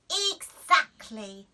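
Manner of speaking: slow, loud gotcha; triumphant. A narrator's reading. Daisy triumphing over mum. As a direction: savour the win. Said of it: "said Daisy".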